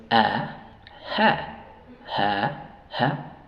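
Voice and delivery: "A" and "ja" with the open "a" of "happen"; short A sound. Shown as a demonstration. Teacher drills the short A, "ja" said as "ha" in separate beats. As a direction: drill "ja" as breathy English "ha", short A. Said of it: "sonido corto de la A es".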